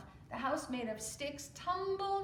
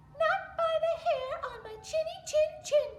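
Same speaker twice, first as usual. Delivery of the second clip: much higher, louder little pig; squeaky defiant pig; 10 dB louder than usual. Acted. Little pig defying the wolf through the door, high and squeaky. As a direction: squeak out the refusal.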